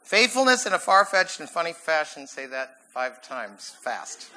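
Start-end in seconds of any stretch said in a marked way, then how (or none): 0.0-2.3 quicker tongue twister. Shown as a demonstration.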